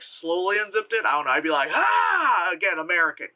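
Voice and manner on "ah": high, drawn-out mock scream; long, raised "ah" of fright; startled scream. Viewer imagines opening the tent, startled mock scream.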